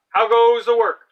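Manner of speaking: high, loud call from the lazy farmer; bossy farmer calling. Acted. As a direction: bellow it like a lazy boss from bed. Farmer voiced.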